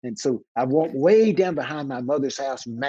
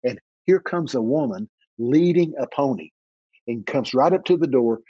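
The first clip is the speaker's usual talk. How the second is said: ordinary talk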